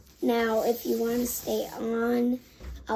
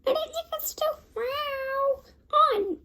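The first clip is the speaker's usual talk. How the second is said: meowing words. Pretend. Still stuck in her cat voice, high meowing. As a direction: talk in a high cat meow. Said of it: "my voice transformed into a meow".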